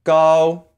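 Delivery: "Gao" held high and level; flat tone. Shown as a demonstration. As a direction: hold "Gao" on one unwavering note. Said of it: "say with a flat tone".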